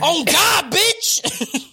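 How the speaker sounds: high, loud mock yell; hammed-up outburst. Retelling the fight to co-hosts, hammed-up yell.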